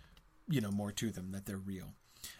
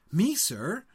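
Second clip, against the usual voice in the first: higher and louder, thrilled disbelief; excited cry; 6 semitones higher, 6 dB louder than usual. Acted. Sam answering Gandalf's sentence, an excited cry. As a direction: Sam yelps at the news, eager as a dog. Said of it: "cried Sam".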